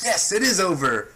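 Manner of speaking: high, loud celebratory cry; hammed-up triumphant shout; mock relief. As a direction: burst out as if finally set free. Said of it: "I'm like".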